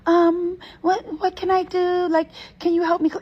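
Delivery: high, hesitant, pleading little voice; pleading girl. Retold or imitated. Teller mimics the girl begging for help. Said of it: "she asked probably five different times".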